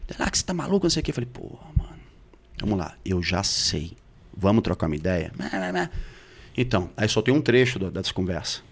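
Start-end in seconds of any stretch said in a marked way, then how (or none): 0.3-1.1 high, soft mock whine; mock her protest in a high voice; mimicked defensive partner. Partner dismissing the accusation as madness, imitated high. 1.3-5.3 low, soft retold appeal; calm, weary restraint. Man urging his partner to talk honestly, low and serene. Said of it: "mais sereno eu fico".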